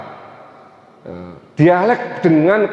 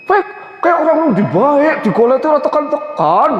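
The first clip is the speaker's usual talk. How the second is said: pitched-up, emphatic Tegal talk; high-toned Tegal father; 9 semitones higher than usual. Retold or imitated. Preacher mimicking his father's everyday talk, high-toned. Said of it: "Bapak saya nadanya kalau bicara lebih tinggi".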